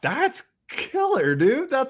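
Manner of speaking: very high, stretched-out squeal of approval; gushing excitement. Acted. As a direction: act thrilled for them, way up high. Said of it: "There's a very different energy".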